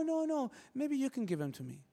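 high-pitched imitation of a reluctant kid; little kid. Little kid asking the store owner to hand over candy, high imitated plea. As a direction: play the kid, high, softening at the end. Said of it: "the little kid's like".

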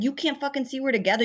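fast, high-pitched exasperated retort; indignant retelling, quick and high; retold indignation. Retold retort to intruding men.

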